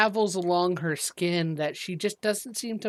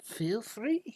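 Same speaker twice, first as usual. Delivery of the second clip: whispering; 6 semitones higher, 6 dB quieter than usual. Acted. Shopkeeper whispers.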